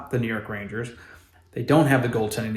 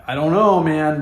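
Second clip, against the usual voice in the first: ordinary talk, nothing marked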